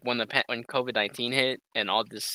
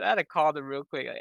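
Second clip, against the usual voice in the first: ordinary talk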